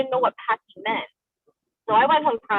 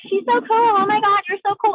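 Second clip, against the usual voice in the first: high, louder imitation of gushing fans; gushing girlfriends; 10 semitones higher, 4 dB louder than usual. Girlfriends praising the pop singer, high gushing imitation. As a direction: mimic excited girls, pitched way up.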